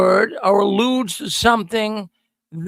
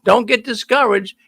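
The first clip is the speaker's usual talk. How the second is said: ordinary delivery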